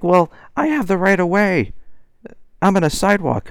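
high mocking whiny lady voice. Vlogger mimics the woman's excuse in a high mocking voice. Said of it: "She's like".

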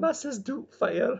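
high, slow mock Scottish granny; hammy Doubtfire Scottish lilt; bad Scottish accent. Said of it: "Bad Scottish accent".

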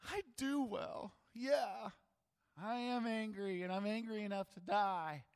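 put-on stomp it out like a sulking child; tantrum. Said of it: "Jonah in full tantrum mode".

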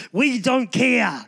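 drawn-out fearless retort; bold defiance. Acted. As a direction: stretch it out, standing up to threats. Defiant reply to threatening leaders.

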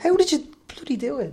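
high, incredulous mock demand; exasperated demand. Retelling pleading with the friend.

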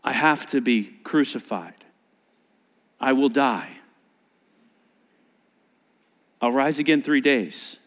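low, matter-of-fact statement; completely plainly. Retold or imitated. Jesus telling disciples he will die, completely plain. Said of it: "he said it completely plainly".

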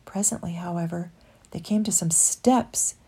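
usual speaking voice

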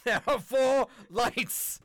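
high, slow, hammed-up defiant cry; defiant quote. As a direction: draw out each word in mock defiance. Acts out famous Star Trek line.

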